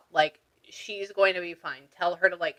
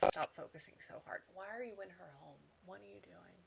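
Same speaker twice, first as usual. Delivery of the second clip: murmured aside; 2 semitones lower, 24 dB quieter than usual. Real, not acted. Medium questioning the spirit in the client's home, murmured aside. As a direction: question someone unseen under your breath.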